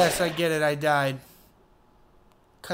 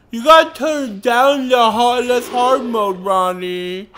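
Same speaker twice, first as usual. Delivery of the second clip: mocking voice; 5 semitones higher, 8 dB louder, slower than usual. Mocking himself in put-on voice.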